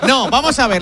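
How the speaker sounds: laughing. Real, not acted. Laughs after being teased.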